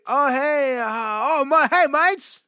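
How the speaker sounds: hammed-up Australian accent yell; loud mock Australian. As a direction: shout it like a fake Aussie greeting a friend. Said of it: "And he's like".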